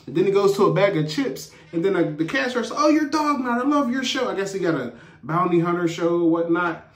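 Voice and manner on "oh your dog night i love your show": high-pitched excited gush; mock fan squeal in words, pitched way up; starstruck fan. Cashier recognizing the bounty hunter, starstruck.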